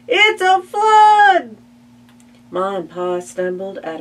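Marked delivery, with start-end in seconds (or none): 0.1-1.6 very high, loud wake-up scream. Acted. Child screaming to wake Ma and Pa at night, very high loud. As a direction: shriek to wake the house. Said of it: "I screamed".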